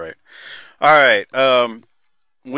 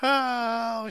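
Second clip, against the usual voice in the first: ordinary talk, nothing marked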